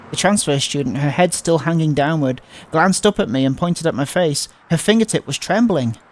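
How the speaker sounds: plain reading voice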